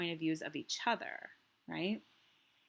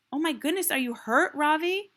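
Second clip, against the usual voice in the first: worried teacher; 10 semitones higher, 10 dB louder than usual. A narrator's reading. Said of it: "Missus Beam asks".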